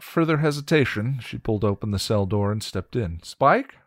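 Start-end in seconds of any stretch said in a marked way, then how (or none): 3.2-3.8 high, loud calling out. Acted. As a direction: call his name into the dark. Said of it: "she called".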